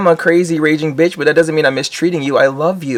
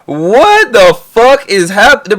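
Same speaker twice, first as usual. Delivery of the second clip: slow, drawn-out outburst of disbelief, pitched up and louder; can't believe what he just heard; stunned disbelief; 8 semitones higher, 6 dB louder, much slower than usual. Real, not acted. Streamer reacting to the crying-in-bed story, stunned and loud. Reacting to shocking clip claim.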